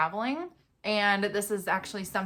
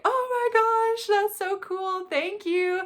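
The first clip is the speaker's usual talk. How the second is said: high, bright imitation of grandpa; polite excitement; 12 semitones higher, 3 dB louder, slower than usual. Grandpa thanking for the card, high and pleased. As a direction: play grandpa pleased but unaware. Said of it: "he was like".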